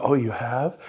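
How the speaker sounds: low, soft, deflated oh you have; bored reaction that kills the call; flat unimpressed reply. Shown as a demonstration. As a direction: let it drop, no interest. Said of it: "some of you will go".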